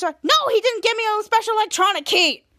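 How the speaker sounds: high-pitched imitated outburst; exasperated retort. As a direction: fire back in mock exasperation. Retold exasperated snap back at Schwoz.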